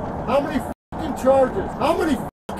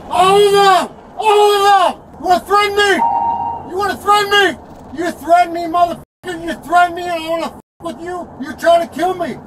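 loud, higher enraged screaming; furious shout; 5 semitones higher, 6 dB louder than usual. Real, not acted. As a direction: scream the accusation right in his face. Arrestee screaming at officer.

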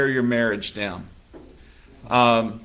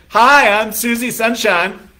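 high, loud, beaming hello; bubbly newlywed introducing herself; chirpy greeting; 8 semitones higher, 5 dB louder than usual. Pretend. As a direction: bounce in like the happiest person alive. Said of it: "you walk into an office and you say".

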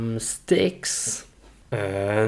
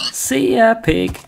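mocking farewell; 10 semitones higher, 3 dB louder than usual. Taunting pig goodbye.

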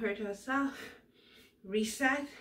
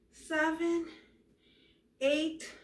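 usual speaking voice

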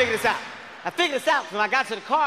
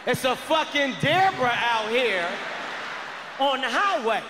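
calling the zoo; 3 semitones higher, slower than usual. Retold or imitated. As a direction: hammer it out to the zoo guy. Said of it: "So I call the zoo".

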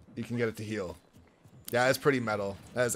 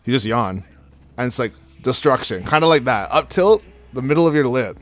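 excited rant; 11 dB louder than usual. Real, not acted. Loud rant about split lip.

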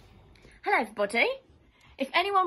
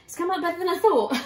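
laughing; 8 semitones higher, 7 dB louder than usual. Real, not acted. Delighted at the baked bread.

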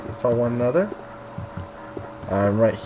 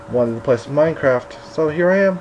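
normal talking voice

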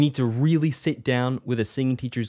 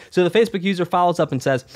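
usual speaking voice

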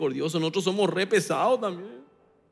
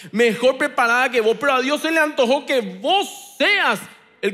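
raised, slowed sermon with punched words; full-force preaching; 6 semitones higher, 4 dB louder than usual. Acted. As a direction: preach it with fire to the congregation.

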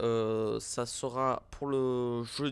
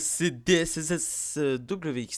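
hammed-up zany youtuber voice, a bit louder; a touch of goofy over-the-top vlogger; crazy side voice; 3 semitones higher, 7 dB louder, slower than usual. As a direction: play your wacky alter ego. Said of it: "Petit côté foufou".

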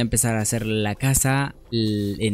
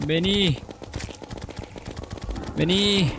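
taunting call; 4 semitones lower, 7 dB quieter, slower than usual. Real, not acted. Gamer taunting the monster to come at him. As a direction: lure him in with a drawn-out dare.

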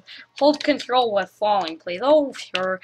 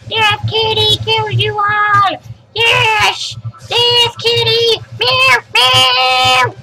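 excited cheer; 10 semitones higher, 7 dB louder, much slower than usual. Acted. Cheering on the death kitty.

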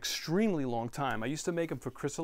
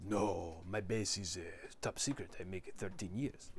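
lightly imitated Italian accent, lower and softer; a hint of the Italian gelato instructor's accent; 6 semitones lower, 8 dB quieter than usual. Imitating his Italian instructor's secretive boast, mild Italian accent. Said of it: "so they have Italian accent".

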